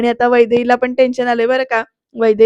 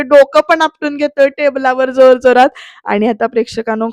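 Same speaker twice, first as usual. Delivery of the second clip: ordinary delivery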